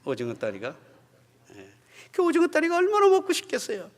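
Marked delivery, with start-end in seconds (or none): 2.1-3.9 put-on high taunting child voice; teasing child. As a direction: tease like a kid showing off a snack. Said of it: "이렇게 놀리는 거예요".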